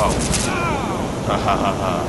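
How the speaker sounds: slow drawn-out evil cackle; slow villain gloating laugh. Acted. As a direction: cackle slowly over the victim. Villain gloats over victim.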